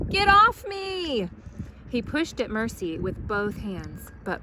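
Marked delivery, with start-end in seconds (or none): 0.1-1.3 high, loud, slow strained cry; desperate. Acted. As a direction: push the words out from under the pig. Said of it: "said Mister Watson".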